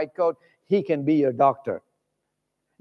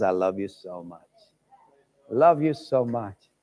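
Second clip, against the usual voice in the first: tender whispering love; 8 semitones lower than usual. Pretend. Jesus speaks from the cross.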